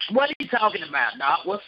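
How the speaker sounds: normal talking voice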